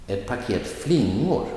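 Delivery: flingor emphasized with a high, swooping tune; light on Ett paket, lean on flingor; stress on flingor. Shown as a demonstration. Teacher models an example phrase, stress on flingor. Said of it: "the noun will get the emphasis".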